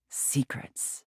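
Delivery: a sudden teasing whisper. Acted. Mistress whispering to her wretch about secrets. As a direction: breathe the word secrets as a hushed whisper.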